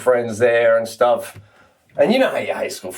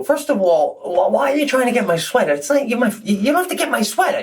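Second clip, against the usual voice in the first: high-pitched, exasperated scolding of a friend; retold protest, voice pitched up in disbelief; indignant confrontation; 9 semitones higher than usual. Confronting drunk friend over sweater.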